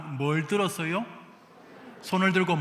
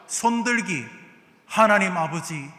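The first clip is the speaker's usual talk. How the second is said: normal talking voice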